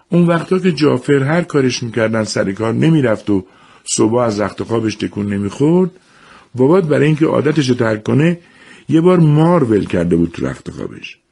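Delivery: lightly voiced mother retelling. A narrator's reading. Mother explains to the children why Jafar changed, lightly voiced. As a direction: tell it like a weary mother recalling.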